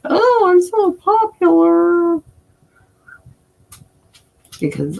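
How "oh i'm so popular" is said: high, slow, drawn-out mock boast; mock bragging. Streamer denying they think they're popular, mock bragging. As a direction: stretch it out loud and high, pretending to show off. Mocks the idea of being popular.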